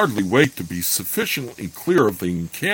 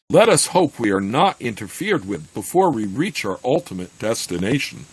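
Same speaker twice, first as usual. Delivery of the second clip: ordinary delivery, nothing marked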